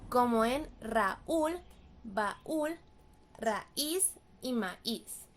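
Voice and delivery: Raúl, baúl, raíz y maíz said very slowly, each vowel of the broken diptong as its own syllable; hiatus spelled out. Shown as a demonstration. Teacher to students showing a broken diptong in Raúl, baúl, raíz y maíz, slow and deliberate. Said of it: "por la ruptura del diptongo".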